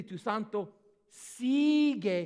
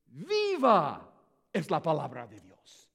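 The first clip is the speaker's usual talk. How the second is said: Viva pushed first and louder; stress on Viva; 5 semitones lower, 4 dB louder than usual. Shown as a demonstration. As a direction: land hard on the word Viva. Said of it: "Está enfatizando esta palabra".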